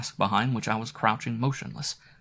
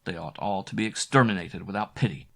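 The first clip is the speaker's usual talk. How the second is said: plain reading voice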